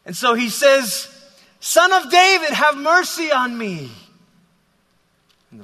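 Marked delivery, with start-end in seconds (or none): 1.6-3.9 loud, high-pitched plea; acted beggar's shout for mercy; crying out. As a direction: call out to someone passing in a crowd. Bartimaeus calls out to Jesus.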